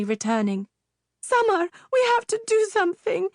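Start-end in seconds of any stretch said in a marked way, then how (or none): 1.2-3.3 very high, fast frantic scream. Acted. Mother screams at her husband to act. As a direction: scream it in panic. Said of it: "Nana screamed".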